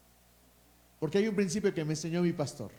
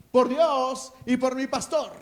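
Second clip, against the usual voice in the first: mock battle cry; 6 semitones higher, 5 dB louder than usual. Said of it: "no queremos gritar así".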